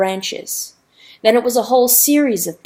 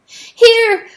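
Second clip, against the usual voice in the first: much higher loud cry; cried; 14 semitones higher, 10 dB louder than usual. Acted. Rooster calling Weasel, high loud cry. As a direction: yell across to the boat. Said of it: "cried Chanticleer".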